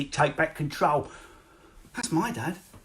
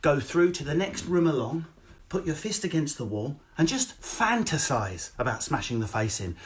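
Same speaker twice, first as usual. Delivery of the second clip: usual speaking voice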